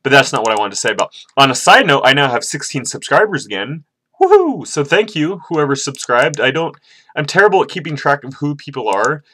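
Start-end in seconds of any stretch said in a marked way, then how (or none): 4.2-4.6 very high, loud whoop; celebratory whoop. Real, not acted. Vlogger celebrating new subscribers, celebratory whoop. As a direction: cheer in a high-pitched squeal.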